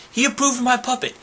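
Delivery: quick, high, delighted brag; proud high-voiced announcement; high-pitched boast. Real, not acted. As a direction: squeal it out quickly. Boasting, then repeating it plainly.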